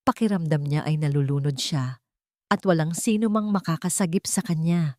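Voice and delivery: plain reading voice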